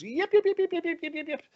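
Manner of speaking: rapid, very high-pitched imitated yipping; squirrel alarm yips. Squirrel sounds the forest alarm, high imitated yipping. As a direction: chirp away like a startled squirrel. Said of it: "the squirrel just starts".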